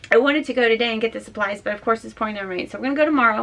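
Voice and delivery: usual speaking voice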